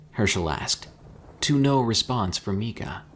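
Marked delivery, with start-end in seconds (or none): none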